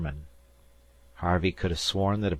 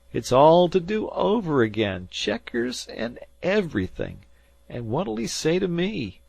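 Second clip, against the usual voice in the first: groaning lament; 11 semitones higher, 6 dB louder than usual. A narrator's reading. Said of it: "Salter groaned".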